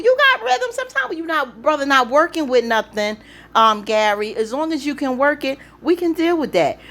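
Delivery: egging her on with delight; excited. Retold or imitated. Vlogger voicing her own hype at the show, excited.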